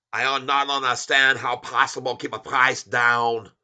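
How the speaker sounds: put-on jokey Chinese accent poking fun at short sellers; mock Chinese accent. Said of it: "make fun of people talk like this".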